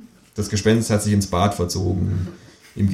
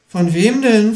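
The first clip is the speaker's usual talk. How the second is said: higher, louder alarmed ask; startled question; 13 semitones higher, 5 dB louder, slower than usual. A narrator's reading. Kangaroo alarmed by the ghost's claim. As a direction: ask in a sudden fright. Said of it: "fragt das Känguru erschrocken".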